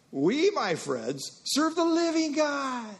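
preaching emphasis. Acted. Preacher's climax.

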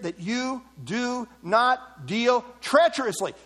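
"you do not deal treacherously" in separate, weighted words; emphatic scripture reading. Shown as a demonstration. Preacher driving home Malachi's warning, emphatic. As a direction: space out each word and land firmly on "treacherously". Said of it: "Note this emphasis".

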